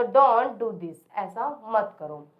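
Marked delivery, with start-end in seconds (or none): 0.0-1.1 stare at me dragged very slowly; slow modelling. Shown as a demonstration. Teacher slowly modelling stare at me for the class. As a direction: draw each word out for learners. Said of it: "teacher models English slowly".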